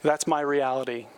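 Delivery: ordinary talk, nothing marked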